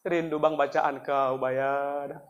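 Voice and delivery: low, soft, slow tearful longing; imitated tearful mother. Mother telling her son she misses his recitation, imitated tearful voice. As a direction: play a weeping mother, hushed and drawn out. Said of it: "Kok nangis mama?".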